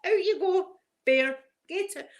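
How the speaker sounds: high, bossy shoo at the bear; lightly voiced auntie snapping a tea towel at a bear. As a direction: chase it out like a no-nonsense auntie. Aunt chasing bear with towel.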